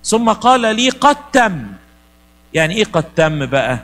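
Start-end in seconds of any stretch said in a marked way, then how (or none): 0.0-1.7 raised, higher declaration; solemn proclamation. Shown as a demonstration. Preacher proclaiming it is done, higher and louder. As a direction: proclaim it as a finished decree.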